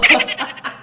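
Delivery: brief high giggle; quick airy laugh; short high laugh. Real, not acted. Closing laugh.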